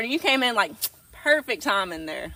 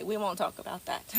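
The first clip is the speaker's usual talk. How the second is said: much softer, under the breath; hushed aside; 4 semitones lower, 11 dB quieter than usual. Real, not acted. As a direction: say it again as a secret to yourself.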